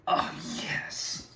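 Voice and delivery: breathy hushed yes; whispered gloat. Acted. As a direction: savor it under your breath. Whispered gloat after cheer.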